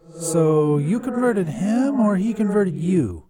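high voice, demon menace gone; puzzled demon underling. A narrator's reading. Deflated demon questions the priest, high puzzled voice. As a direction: ask it slowly, trying to work it out.

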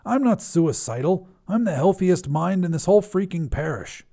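snapped. Acted. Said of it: "the priest snapped".

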